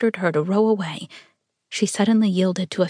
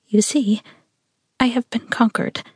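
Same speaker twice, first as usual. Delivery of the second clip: slightly higher, with a little quiver; 4 semitones higher than usual. A narrator's reading. Woman admitting she is conquered, with a little quiver. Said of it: "with a little quiver in her voice".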